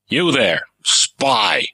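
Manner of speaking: sharp barked call to a spy; pirate captain barks at a stowaway. Acted. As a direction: snap it out at the intruder. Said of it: "Melco barked".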